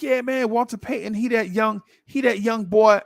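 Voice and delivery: put-on high, eager hype for the young boy; neighbor hyping a rookie. Neighbor hyping Walter Payton to the kid, high and eager. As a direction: sell the rookie like a hidden gem. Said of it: "And he's like".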